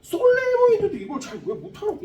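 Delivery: high put-on whine, imitating a student; teasing imitation of a student's whine; mock whiny student. Teacher mimics a frustrated student.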